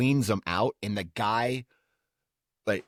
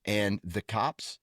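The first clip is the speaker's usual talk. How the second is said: ordinary talk, nothing marked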